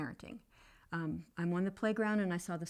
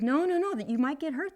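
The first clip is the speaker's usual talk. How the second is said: higher, louder worried refusal; mom's loud no again; 7 semitones higher, 8 dB louder than usual. Retold or imitated. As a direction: shut it down fast.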